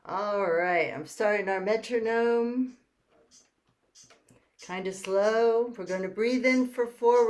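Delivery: ordinary delivery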